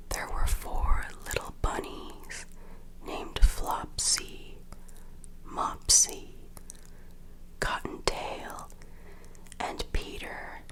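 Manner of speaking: ordinary delivery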